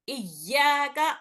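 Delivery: इय्याका said slowly, the या joined and its vowel drawn out; slow recitation. Shown as a demonstration. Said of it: "एक बार पहले वाले हर्फ से हमजा से मिलाते हैं". (धीमी तिलावत)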